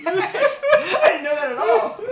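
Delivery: high, slow, laughing disbelief. Real, not acted. Laughing at the candle fact with friends. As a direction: laugh the words out in delight.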